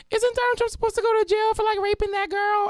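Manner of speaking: put-on high, fast, wide-eyed kid voice; imitating a kid. Teacher mimics a student asking about Trump, high kid voice. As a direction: pitch it way up like a curious student.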